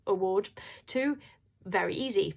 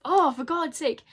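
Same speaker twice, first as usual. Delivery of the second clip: tearful exasperation; 7 semitones higher, 7 dB louder than usual. Real, not acted. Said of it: "emotional again".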